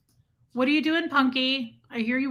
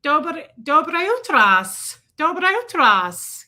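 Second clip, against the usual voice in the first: slow, careful Russian good morning; Russian greeting; 4 semitones higher, 7 dB louder, much slower than usual. Shown as a demonstration. Streamer greeting viewers on request in Russian. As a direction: sound out the Russian greeting slowly and loud. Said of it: "say good morning in Russian".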